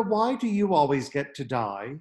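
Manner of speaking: ordinary delivery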